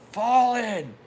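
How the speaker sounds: high, loud drill command; barked command. Retold or imitated. Drill instructor ordering recruits to fall in, barked command. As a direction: bark it across the parade ground. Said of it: "and he's like".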